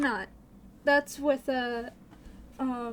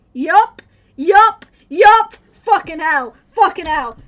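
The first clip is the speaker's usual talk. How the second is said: much louder, higher shouts; shouting; 5 semitones higher, 19 dB louder than usual. Real, not acted. Streamer caught by the embarrassing song, shouting. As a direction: yell it out, caught and exasperated.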